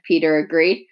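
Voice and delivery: usual speaking voice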